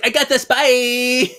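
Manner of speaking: high, drawn-out sing-out; mock cocky sign-off, stretched and high; breezy overconfidence. Phoebe leaves to go undercover, mock breezy confidence. Mimics character leaving on plan.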